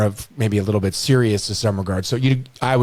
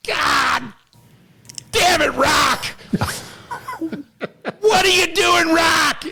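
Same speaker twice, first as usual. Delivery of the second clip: yelling coach; much slower than usual. Retold or imitated. Coach Ray screaming at Rock in practice, high imitated yell. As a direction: bark at Rock like a furious coach.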